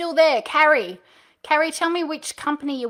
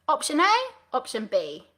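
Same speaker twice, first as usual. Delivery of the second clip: A pitched up, B dropped down; up then down intonation; 2 semitones higher than usual. Shown as a demonstration. Coach demonstrates two choices, voice up on A, down on B. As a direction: lift option A, let option B fall. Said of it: "Voice goes up, voice goes down".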